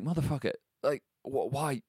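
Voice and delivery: startled outburst. Retold or imitated. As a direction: imitate someone who's just been made to jump. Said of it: "and scares you".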